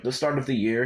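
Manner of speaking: ordinary delivery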